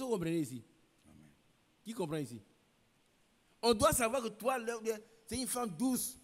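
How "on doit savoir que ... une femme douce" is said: higher, louder preaching. Acted. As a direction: preach it to the congregation. Preaching at full force.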